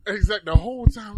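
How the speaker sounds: ordinary talk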